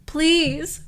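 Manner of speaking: high, loud wailing; a hammed-up desperate driver begging; wailed please. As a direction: wail the word out. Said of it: "wailed the G Z".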